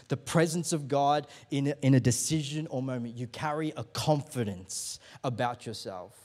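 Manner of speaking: ordinary talk, nothing marked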